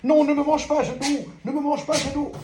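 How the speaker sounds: high squeaky mock plea not to be eaten; playful high pleading to the dog; mock fright. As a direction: squeal like you're scared of the dog, in play. Playing with dog Shadow.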